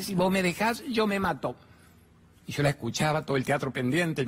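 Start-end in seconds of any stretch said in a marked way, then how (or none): 0.0-1.6 higher, mock-pleading threat; hammed-up desperate plea; desperate clinging man. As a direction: push the whine further up, like a child begging. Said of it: "Me dice".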